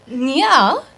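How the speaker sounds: Mandarin with a mock French accent, hammed-up; Chinese with a French accent. Shown as a demonstration. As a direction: play an Air France stewardess speaking Chinese with a French lilt. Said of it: "speak Chinese with a French accent".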